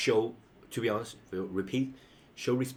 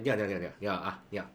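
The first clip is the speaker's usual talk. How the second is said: repeated greeting; much faster than usual. Shown as a demonstration. Showing a chill and informal welcome, quickly repeated hello. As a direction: repeat 你好 in a casual, chattering string. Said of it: "sometimes we repeat 你好".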